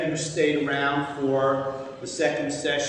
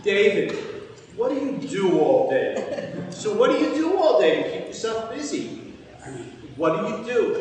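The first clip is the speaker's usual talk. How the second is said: high, loud, insistent questioning; nagging friends; 7 semitones higher, 5 dB louder than usual. Retold or imitated. Friends back home pestering him about his days, nagging. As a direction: pester him with exaggerated curiosity, pitched up.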